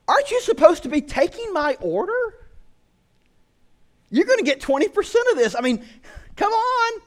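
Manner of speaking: high, indignant complaint to a lazy waiter; exasperated customer. Retold or imitated. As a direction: demand your order, fed up. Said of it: "and I'm like".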